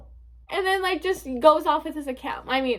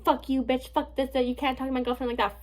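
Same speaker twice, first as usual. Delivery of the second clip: ordinary delivery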